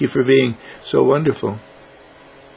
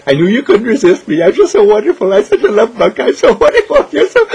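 high, laughing mock brag; giggly boasting; 9 semitones higher, 5 dB louder than usual. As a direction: brag playfully through giggles.

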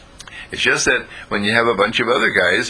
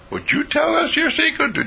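high, eager friend voice; friend's voice. Acted. Friend asking Jesus, high and eager. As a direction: pitch it way up as the curious friend.

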